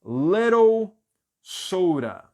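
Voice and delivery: "little" high, loud and stretched, then "soda"; careful model pronunciation. Shown as a demonstration. Teacher gives class the last answer, word by word. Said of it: "vai ser".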